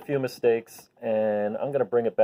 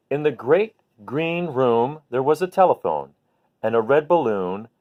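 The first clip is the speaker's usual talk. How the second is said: put-on reading to a child; 3 semitones higher than usual. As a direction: read it gently like tucking a child in. Parent reading bedtime book aloud.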